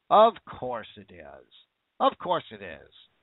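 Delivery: slow, low sarcastic repeat. Real, not acted. Scoffing at the hospital statement, slow and low. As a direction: drag it out with contempt.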